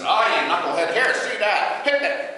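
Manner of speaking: loud gruff Mo; Mo impression. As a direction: bark at Curly then bonk him. Mo answers Curly.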